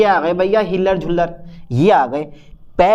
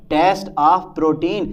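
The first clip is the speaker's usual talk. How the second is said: slowly spelled out; much slower than usual. Shown as a demonstration. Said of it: "spaced Test of protein".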